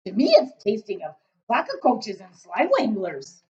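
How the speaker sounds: loud, booming friendly giant; a big-hearted giant rumbling away. Acted. BFG speaking.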